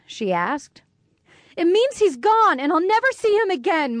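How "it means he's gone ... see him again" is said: shouted. A narrator's reading. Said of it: "Molly shouted".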